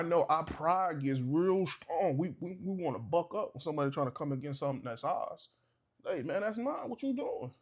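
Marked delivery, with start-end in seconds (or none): 6.0-7.5 higher, challenging bark at a rival; mock confrontation. Man confronting the guy flirting with his wife, hammed-up challenge. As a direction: puff up and call him out.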